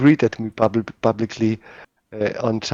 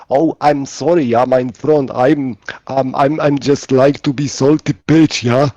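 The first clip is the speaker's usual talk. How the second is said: louder, higher mock-German accent; put-on German accent, mock apology; 4 semitones higher, 6 dB louder than usual. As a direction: imitate a German friend with a thick accent. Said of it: "you do the German accent really good".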